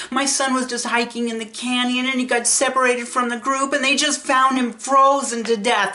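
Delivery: a father breaking down over his son; sobbing uncontrollably. Retold or imitated. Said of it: "he was sobbing uncontrollably".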